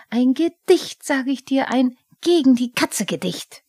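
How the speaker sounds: a stamping goblin ranting about the cat; angry Pumuckl. Acted. Pumuckl schimpft über Katzen.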